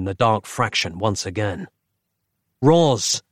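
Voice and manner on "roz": calling out. Acted. Calling out to Roz.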